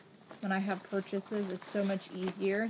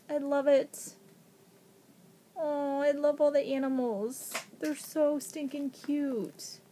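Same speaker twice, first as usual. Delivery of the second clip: normal talking voice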